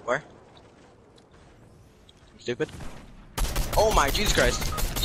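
usual speaking voice

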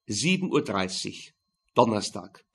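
usual speaking voice

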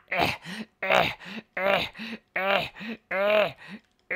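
short, repeated straining grunts; playful exertion noises; effort grunts. Pretend. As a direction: grunt with each push. Straining at the building.

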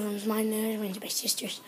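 quick hushed whisper. Real, not acted. Kid whispering an aside to viewers. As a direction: whisper it so the dogs stay calm.

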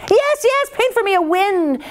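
high, eager, insistent; impatient yes. A narrator's reading. Greedy emperor insisting to Ma Lin, high and impatient.

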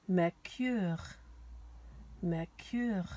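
"Mercure" said the French way, mair-KÜR; French pronunciation. Shown as a demonstration. Teacher giving children the French for Mercury, "Mercure" pronounced mair-KÜR. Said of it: "names of the planets in French".

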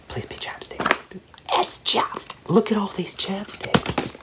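breathless hopeful whisper; excited whisper. Real, not acted. Opening a Burt's Bees gift, eager whisper. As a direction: whisper it like a secret wish.

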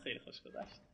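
whispered sarcastic aside. Real, not acted. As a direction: breathe it under your breath. Sarcastic aside after dying.

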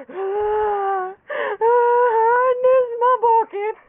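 high, loud, drawn-out mock wailing; hammed-up crying. Walrus in a commercial begging for his lost bucket, hammed-up high wailing. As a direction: wail like a toddler who lost a toy. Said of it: "I'm crying tears".

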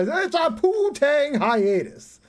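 high, loud, drawn-out joke announcement; mock dramatic proclamation. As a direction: stretch it out like a grand declaration.

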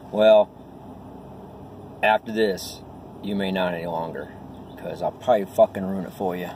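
normal talking voice

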